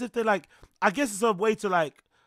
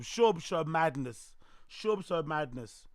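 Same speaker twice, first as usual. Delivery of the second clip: slow; 4 dB quieter, slower than usual. Real, not acted. Slow announce.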